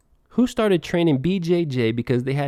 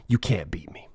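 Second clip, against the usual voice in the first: whisper; 8 semitones lower, 7 dB quieter than usual. Acted. Taunting rival, drops to whisper.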